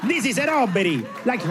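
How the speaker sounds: high, cartoonish Italian robber; hammed-up, unscary stick-up; Super Mario. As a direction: hold up a bank in a high, bouncy Italian cartoon voice. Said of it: "if you sound like Super Mario".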